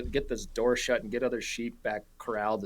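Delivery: ordinary talk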